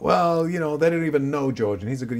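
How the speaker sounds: mild mock huff. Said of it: "What else are you angry about".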